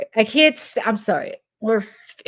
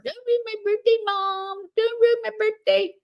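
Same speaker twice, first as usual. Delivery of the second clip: much higher bratty whine; whiny middle schooler; 13 semitones higher than usual. Retold or imitated. Mocking her as a middle schooler whining to mom. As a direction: whine at mom like a spoiled kid. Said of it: "out of a middle schooler".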